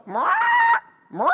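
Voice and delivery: repeated sharp parrot screeches; parrot squawk. Retold or imitated. Dolly the parrot warning in the mine shaft, repeated squawks. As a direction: squawk twice like an alarmed bird. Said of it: "And Dolly's going".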